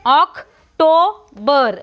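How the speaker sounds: October drawn out slowly, ok-toe-ber; slow correct October. Shown as a demonstration. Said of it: "M is not there".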